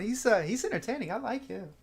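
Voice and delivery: high, loud, amused Southern drawl; bright chuckling drawl, high and loud. Acted. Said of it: "chuckles and goes".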